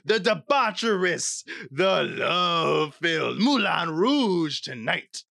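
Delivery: hammed-up French cabaret host; French accent. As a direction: roll out a grand French-accented welcome. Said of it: "one of your better accents".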